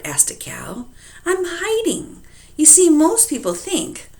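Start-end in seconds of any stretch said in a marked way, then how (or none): 1.2-4.1 high, small dragon voice; baby dragon. Acted. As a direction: explain shyly as a little dragon.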